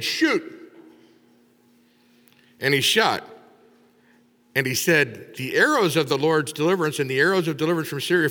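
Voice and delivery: loud, driving sermon delivery; full-force preaching. Acted. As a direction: preach it out to the congregation.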